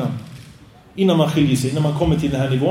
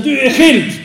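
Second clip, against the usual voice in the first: high, loud blurted divorce outburst; furious shout; 9 semitones higher, 6 dB louder, slower than usual. Acted. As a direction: fling it out in a rage. Acted marital quarrel, divorce blurted. (husbands rop i grälet: rasande utrop)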